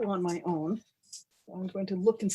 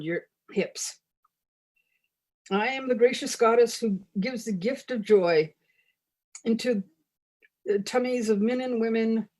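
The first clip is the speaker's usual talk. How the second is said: grand goddess proclamation, louder than chat; mock-solemn ritual reading; 3 semitones higher, 6 dB louder than usual. Chocolate goddess addressing her hungry followers, mock-solemn proclamation. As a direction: declaim it like a tongue-in-cheek scripture.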